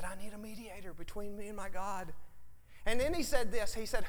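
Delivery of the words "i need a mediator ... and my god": much softer, faster plea; hushed plea. Retold or imitated. Job pleading for a mediator, hushed and soft. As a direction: murmur the longing.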